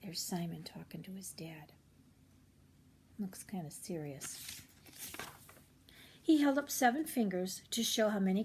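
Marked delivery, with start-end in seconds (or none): 0.0-4.6 hushed aside. Real, not acted. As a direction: whisper as if watching secretly. Narrator whispered aside.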